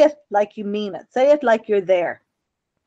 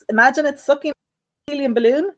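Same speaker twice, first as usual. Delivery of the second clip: normal talking voice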